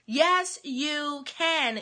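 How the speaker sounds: Yes, you can. drawn out slowly; very slow encouraging Yes, you can; slow affirmation. Real, not acted. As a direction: stretch each word, encouraging. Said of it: "affirming the audience slowly".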